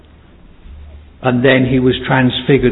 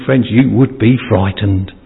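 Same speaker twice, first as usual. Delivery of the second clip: usual speaking voice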